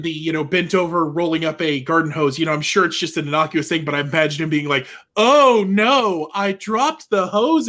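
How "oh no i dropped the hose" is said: slow, campy, higher and louder "oh no". Retold or imitated. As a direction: ham up the dropped-hose gasp. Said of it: "being real, like, camp about it".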